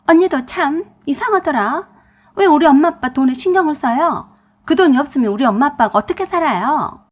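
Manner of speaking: high, loud indignant outburst; angry sister-in-law. A narrator's reading. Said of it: "성질을 내면서 화를 냈어요". (화가 나서 높고 크게 따지는 시누이 목소리)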